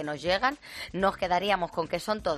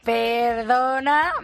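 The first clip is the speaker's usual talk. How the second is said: loud, very drawn-out "excuse me"; sarcastic retort; 3 semitones higher, 5 dB louder, much slower than usual. Acted. Host answering the British journalist back, drawn-out sarcasm. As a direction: stretch it out in mock indignation.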